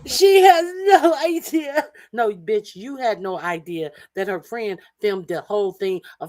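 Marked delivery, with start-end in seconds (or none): 0.1-1.9 high, slow sing-song gloat with laughter. Real, not acted. Man gloating over the woman caught on video, laughing sing-song. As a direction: laugh it out mockingly, drawn out.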